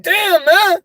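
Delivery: high, startled damn; half-joking exclamation; mock surprise. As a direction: react like you just saw it. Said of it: "I'm like".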